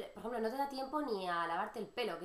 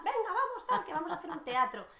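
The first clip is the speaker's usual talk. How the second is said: put-on high and louder kid urging, let's do a play; excited child voice, high and loud; eager kid; 8 semitones higher, 4 dB louder than usual. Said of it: "child organizing show".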